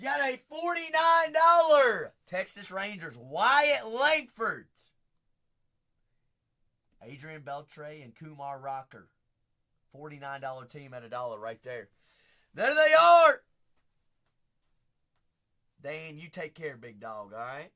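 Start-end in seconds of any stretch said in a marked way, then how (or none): 0.0-4.7 shouting the team out, pumped; excited shout. Real, not acted. Host hyping a forty-nine dollar team to viewers, loud shout. Hyping a big auction win. 12.5-13.4 loud, high, triumphant call; excited shout. Real, not acted. Host cheering the cards landing, loud high shout. Celebrating the hit.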